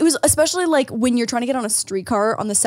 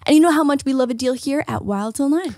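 an upbeat podcast ad read; 3 semitones higher than usual. Acted.

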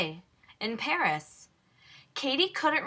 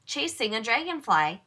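usual speaking voice

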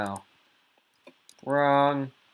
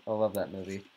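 ordinary talk, nothing marked

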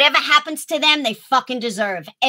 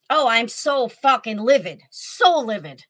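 ordinary talk, nothing marked

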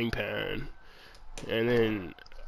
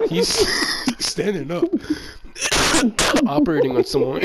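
laughing; 8 semitones higher, 6 dB louder, faster than usual. Real, not acted.

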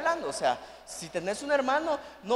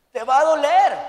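loud emphatic warning; preaching shout; 4 semitones higher, 11 dB louder than usual. Real, not acted. Preacher stressing pain. (advertencia gritada con fuerza)

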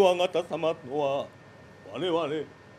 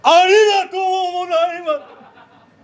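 高く大きく早口でお礼; 感激したふりで一気に言う; 大げさな感謝; 10 semitones higher, 11 dB louder, much faster than usual. Acted. 大クワガタへの大げさな礼. (大クワガタに高く大きく早口でお礼)

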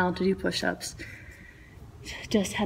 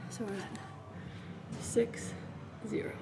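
breathless whispered counting; doing push ups; 4 semitones higher, 9 dB quieter, slower than usual. Real, not acted. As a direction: gasp out each rep count.

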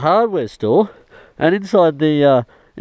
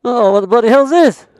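ordinary talk

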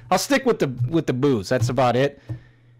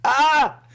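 gleeful high yelp; 12 semitones higher than usual. Real, not acted. After laughing at the fights.

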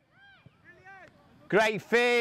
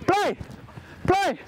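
shouting; 8 semitones higher than usual. Real, not acted. Coach calling out during a match.

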